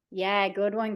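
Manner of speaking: normal talking voice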